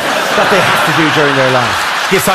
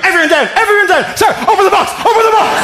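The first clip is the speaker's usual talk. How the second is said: very high, fast yelling; frantic security officer, voice shooting up; panicked command; faster than usual. Acted. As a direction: scream orders at a suspect. Security officer finally raises alarm.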